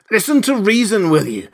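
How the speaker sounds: loud, high exasperated order; exasperated demand. A narrator's reading. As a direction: snap it mid-struggle. Struggling to subdue Kemp.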